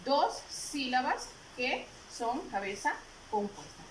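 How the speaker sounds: usual speaking voice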